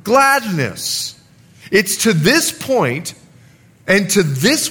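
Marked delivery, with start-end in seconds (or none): 0.0-1.1 gladness lifted a touch, set apart; stress on gladness. Shown as a demonstration. Pastor to congregation, stressing the key word gladness. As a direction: land firmly on gladness. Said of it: "I emphasize the word".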